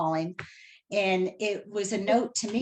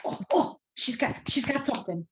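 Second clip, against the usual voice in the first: high, eager little gasp, then rushing on; thrilled that the medium is calling; excited; 7 semitones higher than usual. Retold or imitated. Seeing Amy's name on her phone, high eager excitement. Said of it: "I'm like".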